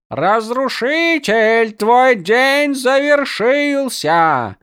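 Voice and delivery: high, slow booming war cry from helmet; echoing voice inside helmet. Acted. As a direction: call it out through a helmet. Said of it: "раздался её голос из-под шлема".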